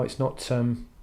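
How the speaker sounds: usual speaking voice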